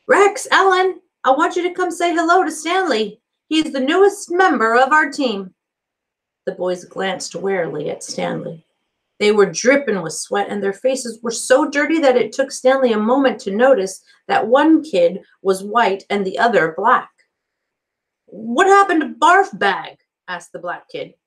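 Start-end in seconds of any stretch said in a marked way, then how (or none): 0.1-5.5 calling out. A narrator's reading. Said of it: "Mister Pendanski called to them". 18.3-20.0 lightly voiced blunt boy; a hint of a wary teen; tough camp kid. A narrator's reading. Boy asking the counselor, lightly voiced and blunt.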